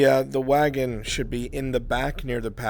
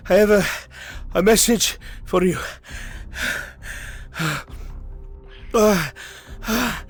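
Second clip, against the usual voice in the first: out-of-breath messenger; 6 semitones higher than usual. Acted. Exhausted runner reporting to Lord Erhard, badly winded. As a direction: pant out the message in broken bursts.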